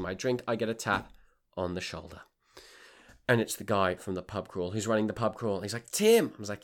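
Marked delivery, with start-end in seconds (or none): 5.9-6.3 high, loud call of Tim. Retold or imitated. Pub crawl guy calling Tim over, loud. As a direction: call his name across the club.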